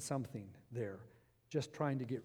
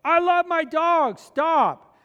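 much higher and louder, slow furious protest; a dog owner refusing more money, shouting slowly; offended outburst; 13 semitones higher, 15 dB louder, much slower than usual. Retold or imitated. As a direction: explode at the buyer, stretching each word. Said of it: "She was just highly offended".

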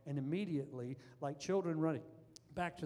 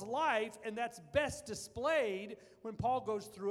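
full-force preaching; 5 semitones higher, 4 dB louder than usual. Acted. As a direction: preach it hard, building toward the climax.

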